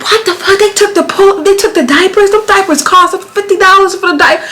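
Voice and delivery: high, disbelieving rant; outraged. Retold or imitated. Ranting to her husband about the stolen diapers, high outraged disbelief. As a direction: let the outrage spill out. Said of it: "I'm heated".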